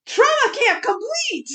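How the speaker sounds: high, loud, slow triumphant call; gleeful mock announcement. Announcing the end of the kids' ordeal, mock-triumphant. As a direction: announce it like a finished game level. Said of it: "shouldn't have said that in that tone".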